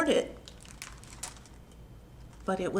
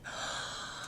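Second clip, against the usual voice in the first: excited gasp; 15 dB quieter than usual. Acted. Reveal gasp.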